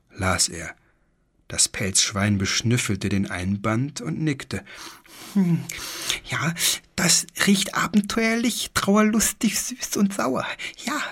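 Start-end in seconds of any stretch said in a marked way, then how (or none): 5.3-11.0 very high, half-whispered little beast sniffing a book; high lisping creature. A narrator's reading. Fur pig sniffs the pirate book, high whispering creature voice. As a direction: savour the smell like a greedy tiny creature.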